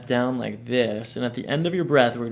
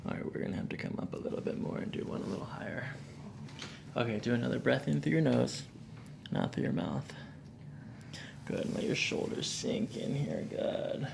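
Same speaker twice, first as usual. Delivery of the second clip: soft spa whisper; 9 dB quieter than usual. Acted. Calming whisper during adjustment.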